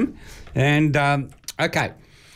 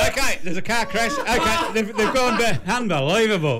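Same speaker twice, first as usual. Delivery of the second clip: words higher through giggles; laughing at the mess-up; laughing while talking; 7 semitones higher than usual. Real, not acted. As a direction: try to restart while still laughing. Restarting joke while laughing.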